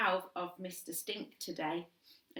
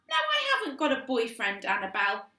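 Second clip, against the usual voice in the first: irritated; 6 semitones higher, 8 dB louder than usual. Acted. Irritated older sister.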